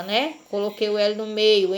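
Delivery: normal talking voice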